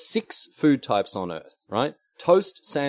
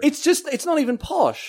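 exasperated. Real, not acted. Indignant protest, very high.

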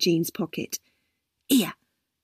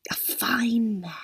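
very slow wistful whisper; old lady lost in memories, slow whisper; dreamy whisper; 3 semitones higher, 4 dB quieter, slower than usual. A narrator's reading. Fading murmur.

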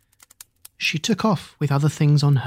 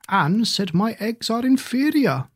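hen voice; 6 semitones higher than usual. Acted. Hen complains.

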